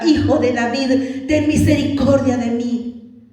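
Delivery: loud, slow, drawn-out plea; crying out. Acted. Blind man calling on the son of David, loud pleading cry. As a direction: cry out loudly and stretch the words. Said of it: "él clamaba y lo llamaba".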